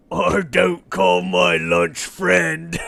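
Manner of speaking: slow, loud giant voice; booming giant mocking its lunch. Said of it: "the other head replies in giant and says".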